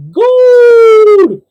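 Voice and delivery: loud, high, drawn-out cry; gleeful slide shout. Acted. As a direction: holler it while whizzing down a slide. Acting man shouting down magic slide.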